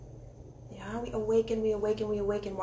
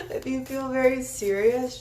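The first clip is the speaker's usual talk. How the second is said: louder, higher, words broken by laughter; laughing through it; 4 semitones higher, 6 dB louder than usual. Real, not acted. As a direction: try to say it but keep laughing. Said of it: "laugh at my stuttering".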